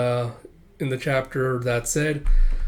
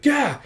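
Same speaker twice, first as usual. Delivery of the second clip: high "gah" yelp; startled yelp; 13 semitones higher, 4 dB louder than usual. Acted. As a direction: yelp in sudden mock fright. Startled by the dog.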